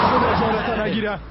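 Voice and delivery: laughing. Acted. Laughing at the gossip.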